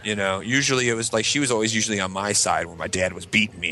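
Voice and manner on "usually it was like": usual speaking voice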